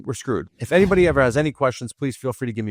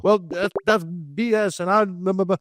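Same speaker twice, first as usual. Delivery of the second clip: mock riled-up outburst; 6 semitones higher than usual. Mock riled-up witness answer.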